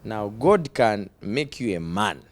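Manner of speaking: ordinary delivery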